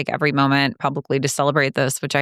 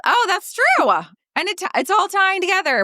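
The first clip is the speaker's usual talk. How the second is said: surprised. Real, not acted. Realizing bathroom link.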